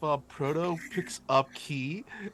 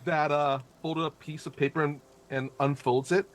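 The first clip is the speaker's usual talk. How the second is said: ordinary talk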